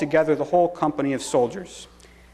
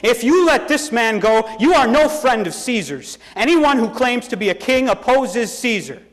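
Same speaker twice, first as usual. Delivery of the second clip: loud, high accusing cries; crowd shouting; 7 semitones higher, 4 dB louder than usual. A narrator's reading.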